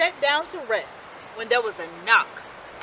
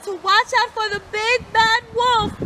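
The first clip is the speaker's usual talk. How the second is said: higher, louder mother calling after them; 9 semitones higher, 6 dB louder than usual. A narrator's reading. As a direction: call a warning after the pigs. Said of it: "called their mother as she waved goodbye".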